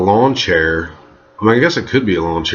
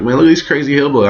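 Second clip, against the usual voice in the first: amazed exclamation; 7 semitones higher, faster than usual. Retold or imitated. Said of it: "I'm like".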